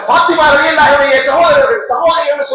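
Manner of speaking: put-on high, excited child announcing; child shouting who his family is, elated; joyful cry. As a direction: proclaim it proudly. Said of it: "கத்திக்கிட்டே ஓடுறாங்க".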